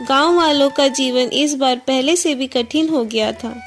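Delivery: plain reading voice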